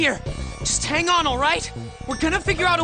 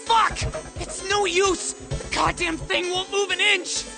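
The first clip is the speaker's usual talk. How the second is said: high, furious shout; frustrated yelling; slower than usual. Acted. Brother unable to open the door, furious yelling. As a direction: yell in helpless rage at the stuck door.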